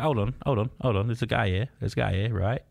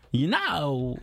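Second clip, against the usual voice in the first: Christopher Walken; 4 semitones higher than usual. Retold or imitated. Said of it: "Christopher Walken make a great vampire".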